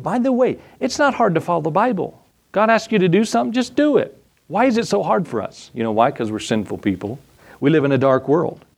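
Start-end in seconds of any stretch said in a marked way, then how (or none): 0.0-5.7 high-pitched, insistent preacher's plea; exasperated exhortation. Real, not acted. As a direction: urge them, pitched well up, as if it were obvious. Preacher exhorting congregation to obey.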